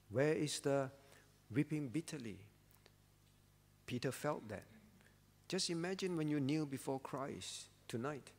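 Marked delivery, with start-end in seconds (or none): none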